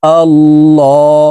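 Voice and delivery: আল্লাহ drawn out slowly with a thick ল; heavy lam. Shown as a demonstration. Teacher modelling the thick ল, slow chant. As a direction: stretch আল্লাহ with a full, heavy ল. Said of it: "লামকে ল বলে পড়তে হবে".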